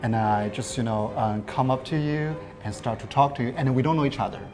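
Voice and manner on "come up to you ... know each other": ordinary delivery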